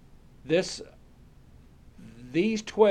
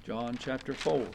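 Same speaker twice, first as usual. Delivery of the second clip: much softer, half to himself; murmured to self; 5 semitones lower, 9 dB quieter than usual. Real, not acted. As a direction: mumble it while turning pages. Murmured while finding the page.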